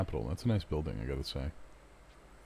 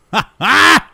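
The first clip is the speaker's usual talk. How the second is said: loud high laugh; 16 dB louder than usual. Real, not acted. Burst of laughter.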